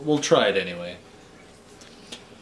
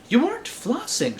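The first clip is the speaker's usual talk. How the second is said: much higher, sing-song scolding; scolding dentist; 12 semitones higher than usual. A narrator's reading. Dentist scolding young Drac, high sing-song tone. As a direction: tut at a naughty patient. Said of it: "she scolded".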